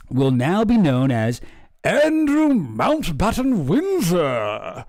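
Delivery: very slow, grand pompous read; mock royal announcement. Reading out the new name, pompous and drawn out.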